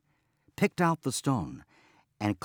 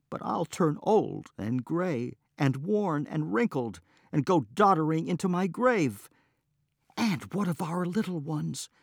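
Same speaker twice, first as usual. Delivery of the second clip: upset protest; 7 semitones higher, 4 dB louder than usual. Acted. Wife protesting her fate.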